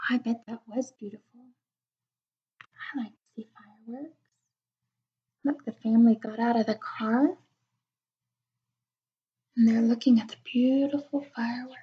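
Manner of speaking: quick soft hushed aside; fast whisper. Acted. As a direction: rush it under your breath.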